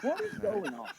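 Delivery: high-pitched comic bewilderment; baffled outburst. Acted. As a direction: exclaim in laughing, incredulous exasperation. Baffled by friend's perfect teeth.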